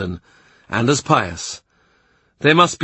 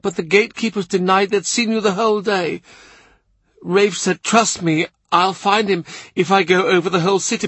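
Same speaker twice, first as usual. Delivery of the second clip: higher, urgent woman's voice throughout; Mercy pleading, all in a rush of worry; distressed Mercy; 8 semitones higher than usual. A narrator's reading. Mercy telling him they searched, higher anxious voice.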